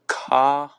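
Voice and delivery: "Khalifa" loudly broken into separate syllables; struggling pronunciation. Real, not acted. Another attempt at a hard name, struggling pronunciation. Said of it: "Can't even say that".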